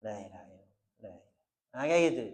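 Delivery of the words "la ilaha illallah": La ilaha illallah dilafalkan lirih dan rendah; light soft recitation. Shown as a demonstration. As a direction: lafalkan La ilaha illallah pelan dengan bibir terbuka. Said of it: "Itu ringan sekali dibaca". (La ilaha illallah dibaca lirih)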